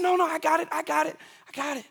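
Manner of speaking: put-on high, insistent little boy refusing help; stubborn toddler. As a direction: brush off help like a proud three-year-old. Father voices stubborn son.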